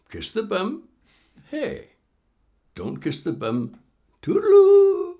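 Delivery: high, teasing singsong; mocking singsong. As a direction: taunt it gleefully. Jesper's mocking singsong offer.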